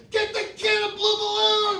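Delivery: high, loud frantic yell; screaming. Retold or imitated. Dad at the register demanding a balloon, frantic yell. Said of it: "I'm screaming".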